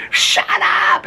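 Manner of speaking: loud, very high-pitched holler; imitated neighbor's yell. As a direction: yell at a howling dog like an exasperated neighbor. Imitating neighbor yelling at howling dog.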